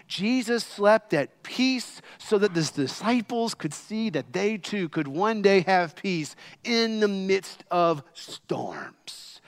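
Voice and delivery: usual speaking voice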